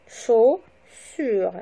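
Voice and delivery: "Chaussures" split chau, pause, ssures; syllable by syllable. Shown as a demonstration. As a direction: break "Chaussures" into two slow, separate syllables. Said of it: "le découpage syllabique".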